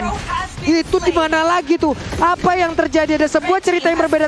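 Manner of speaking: sudden high, excited outburst; surprised. Real, not acted. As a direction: caught off guard, voice jumps up. Caster reacts to sudden fight.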